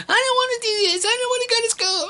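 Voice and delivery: high whining child, imitating; mock whine like a kid who won't go; high-pitched kid whine; whiny kid voice. Kid whining about school.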